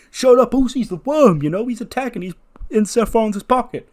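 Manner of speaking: high, loud accusing outburst; angry shouting. Acted. [name] gets in Jimmy's face, shouting angrily.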